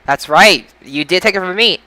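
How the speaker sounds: ordinary delivery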